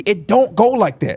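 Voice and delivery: ordinary delivery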